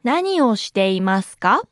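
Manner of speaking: 何をしていますか？ word by word, rising at the end. Shown as a demonstration. Teacher's slow model, then natural.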